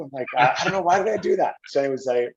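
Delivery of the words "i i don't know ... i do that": high, loud flustered stammer; mock-panicked, pitched well up; retold panic. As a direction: blurt it out in mock regret. Retold panic after provoking giant.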